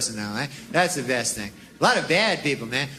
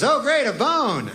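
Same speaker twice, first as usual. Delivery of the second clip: slow, higher sarcastic drawl; unimpressed dog, slowly sarcastic; 7 semitones higher, slower than usual. Acted. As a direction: sneer at the gift. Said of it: "Dog gets it, goes".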